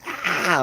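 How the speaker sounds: high, loud mimicked kid scream; toddler's scream. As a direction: shriek like a screaming two-year-old. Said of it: "Screaming at me".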